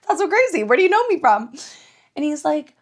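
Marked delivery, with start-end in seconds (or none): 0.0-1.5 high-pitched, giggly feigned amazement; acted polite surprise. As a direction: replay being surprised by a stranger. Acts out surprise at being recognized.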